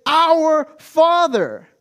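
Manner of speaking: loud, high, drawn-out proclamation; audacious address. Shown as a demonstration. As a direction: call it out boldly, stretching both words. Audacity of calling God Father.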